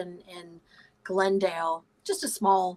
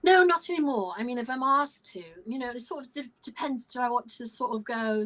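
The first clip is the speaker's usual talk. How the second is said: British accent; 3 semitones higher than usual. Shown as a demonstration. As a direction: answer in a playful British accent. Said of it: "do you still have the British accent?".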